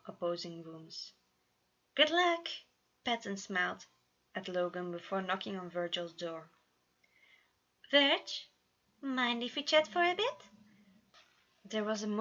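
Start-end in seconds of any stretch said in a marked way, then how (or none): 1.9-2.5 much higher, louder bright send-off; smiling high good luck; cheery well-wish. A narrator's reading. Patton smiling to Logan. 7.9-10.5 higher, louder friendly call; cheery dad knocking on a door; gentle high call to Virgil; calling through door. A narrator's reading.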